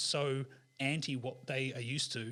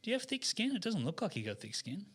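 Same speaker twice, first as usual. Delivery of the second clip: much higher, puzzled little monkey; 8 semitones higher than usual. Acted. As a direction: pipe up doubtfully like a small monkey. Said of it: "Monkey goes".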